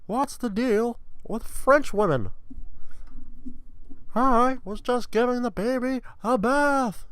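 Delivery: high, loud stand-up comic voice; slow, drawn-out observational whine way up high; Jerry impression. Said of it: "I'll just do it Jerry time".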